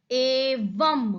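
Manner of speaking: एवं drawn out, ending on a held m; slow एवं, letting the m hum; sounded final m. Shown as a demonstration. Teacher points out the m sound, एवं stretched slowly. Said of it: "फिर से माँ की ध्वनि सुनाई दे रही".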